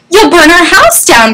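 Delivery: high, alarmed mother's voice; mildly frantic outcry; crying out. A narrator's reading. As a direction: cry it out as a storybook warning. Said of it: "cried his mother".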